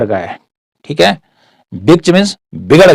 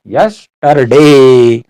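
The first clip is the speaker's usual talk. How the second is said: yesterday stretched very slowly and a bit louder; yesterday held long and firm, like a teacher repeating it; drawn out; 4 dB louder, much slower than usual. Shown as a demonstration. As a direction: draw out every sound of yesterday slowly for the class.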